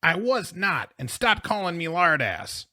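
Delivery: high, indignant retort; angry protest. A narrator's reading. Boy insulted as Lardass answering back, angry. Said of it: "red-faced and angry".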